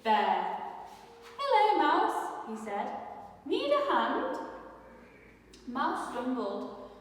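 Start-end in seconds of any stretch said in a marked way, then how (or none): 1.4-2.2 bright high friendly hello; friendly bear greeting. Acted. 3.4-4.4 high, kind offer of help; friendly offer. Acted.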